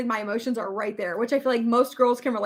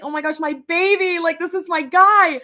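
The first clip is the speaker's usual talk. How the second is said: retold excitement, higher and louder; excited; 5 semitones higher, 6 dB louder than usual. Vlogger recalling getting her first dog, excited gushing. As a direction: gush like a thrilled new owner, voice lifted. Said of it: "I was so excited because".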